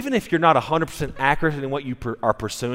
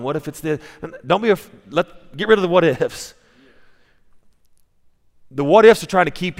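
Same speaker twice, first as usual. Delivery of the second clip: louder, driving preacher's voice; forceful preaching; 4 semitones higher, 4 dB louder than usual. Acted. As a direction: preach it with rising conviction to the church. Pastor preaching with rising force.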